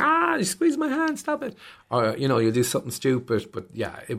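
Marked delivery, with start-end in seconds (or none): 0.0-1.6 put-on high, whining child; whiny kid. Kid complaining about a squeezed hand, high whine. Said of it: "And you're like".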